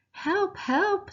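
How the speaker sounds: high, loud panicked scream; high-pitched loud cry for help. Acted. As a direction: shriek it out like a terrified mum. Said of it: "screamed Mum".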